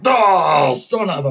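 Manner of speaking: imitating a player's loud frustrated outburst; mock cut-off curse, loud on the oh; a player cursing a bad shot, mock frustrated yell.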